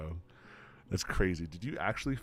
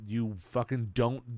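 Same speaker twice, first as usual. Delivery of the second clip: ordinary talk, nothing marked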